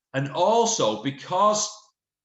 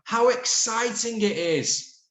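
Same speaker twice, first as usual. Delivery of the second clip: high, slow excited exclamation; exclaimed; 9 semitones higher, 4 dB louder, slower than usual. Shown as a demonstration. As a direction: exclaim it with delight. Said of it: "Exclaimed Sarah".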